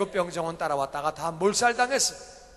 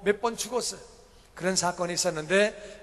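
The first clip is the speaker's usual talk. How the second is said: ordinary talk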